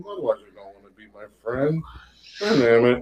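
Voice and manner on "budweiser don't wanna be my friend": low, soft mock disappointment; brought back down. Friend retells losing Budweiser's friendship, mock letdown. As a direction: drop it down, deflated. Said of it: "I was brought back down".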